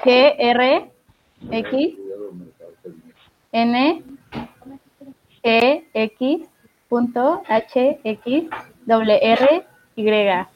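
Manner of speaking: letter by letter, with pauses between; spelling it out. Shown as a demonstration. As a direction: spell each letter out slowly. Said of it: "Mejor deletréalo". (deletreando letra por letra)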